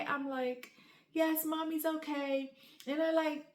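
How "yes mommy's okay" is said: slow, higher, softer comforting voice; retold soft motherly reassurance; reassuring mom. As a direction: gently put on okay for the kids. Reassuring her children while upset.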